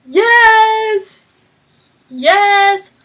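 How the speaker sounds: high squeal building to loud yeses; hammed-up happy-crying reaction; overjoyed, mock tearful. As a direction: start small and high, then cheer. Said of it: "crying, 'cause I'm like".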